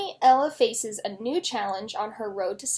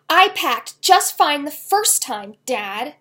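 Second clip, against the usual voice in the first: loud, slow, defiant; angry retort; 4 semitones higher, 8 dB louder, slower than usual. A narrator's reading. Girl defying her dad in the parking lot, angry. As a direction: lean on every word. Heated father-daughter argument.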